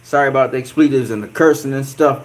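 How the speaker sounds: usual speaking voice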